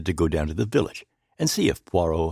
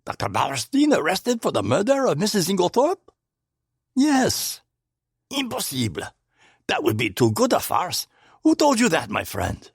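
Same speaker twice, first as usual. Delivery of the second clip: feigned surprise; 11 semitones higher than usual. A narrator's reading. Poirot feigns astonishment.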